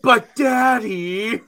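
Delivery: high, slow crying whine; crying child. Retold or imitated. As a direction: whine like a crying little girl. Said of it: "her response would be like".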